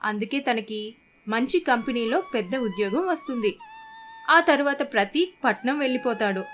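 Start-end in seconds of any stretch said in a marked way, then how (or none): none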